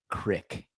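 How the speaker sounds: "crick", short clipped vowel for creek. Shown as a demonstration. Host citing Oregon hills pronunciation, "crick". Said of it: "How is C R E E K".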